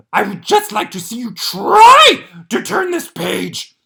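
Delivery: high, loud, slow furry monster shouting; a panicked puppet monster begging the reader; Grover voice. Acted. Grover building a brick wall.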